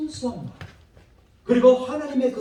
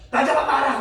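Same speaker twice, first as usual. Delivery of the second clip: put-on higher, teasing kid's catch-me call; playful child taunt; 6 semitones higher than usual. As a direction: sing it out like a kid running off. 아이가 뛰어다니며 놀림. (높게 놀리는 아이 말투)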